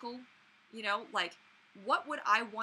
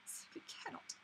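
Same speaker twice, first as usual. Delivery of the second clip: whispered dramatic catchphrase; whispered Rarity line; 8 semitones higher, 10 dB quieter than usual. Real, not acted. Vlogger whispers her favorite Rarity line to herself. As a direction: breathe it out in a hush. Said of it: "I say it all the time".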